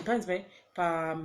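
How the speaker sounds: ordinary talk, nothing marked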